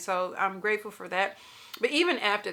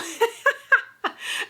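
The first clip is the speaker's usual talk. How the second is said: real laugh breaking out high; giggling at herself; 14 semitones higher, 5 dB louder than usual. Real, not acted. Laughing at her own story.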